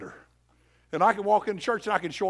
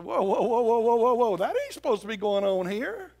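mock alarm; 4 semitones higher, 4 dB louder than usual. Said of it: "He said".

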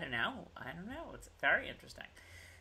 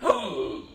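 sharp acted gasp; sudden intake of breath for effect; mock startled gasp. Host retelling the sudden attack, mock startled gasp.

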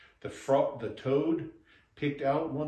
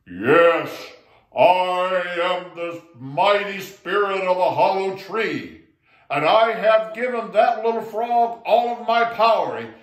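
booming, much louder spirit voice; loudest voice; 6 semitones higher, 11 dB louder than usual. Acted. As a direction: boom it out like a mighty spirit. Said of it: "said in his loudest voice".

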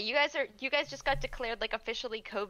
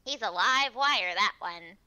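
slow, lightly put-on cop voice; character voice; 6 dB louder, slower than usual. Cop remarking on the detective, character voice. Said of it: "this voice that you're doing".

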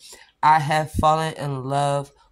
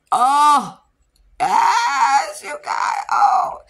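excited shout; 9 semitones higher, 6 dB louder, much slower than usual. Real, not acted. Thrilled reaction to the polish.

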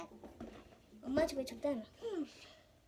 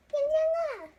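loud high call; 10 semitones higher, 11 dB louder than usual. Real, not acted. Calling the child loudly.

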